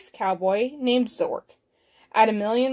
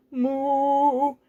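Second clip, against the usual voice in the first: long, level moo; cow moo. Acted. Cow newly landed on a strange planet, mooing.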